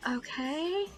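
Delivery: soft, unimpressed okay; deadpan okay. Retold or imitated. Guide answering the scared girls, soft deadpan. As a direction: let it drop flat and unimpressed. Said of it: "And I'm like".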